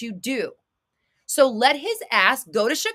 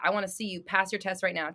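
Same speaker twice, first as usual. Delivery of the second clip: usual speaking voice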